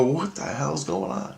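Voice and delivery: high-pitched, puzzled question; retold bewilderment. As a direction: act out baffled disbelief, voice rising. Retold bewildered reaction to yelling.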